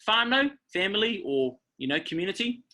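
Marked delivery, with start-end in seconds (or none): none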